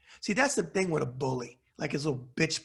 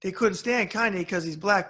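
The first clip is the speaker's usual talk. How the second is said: ordinary talk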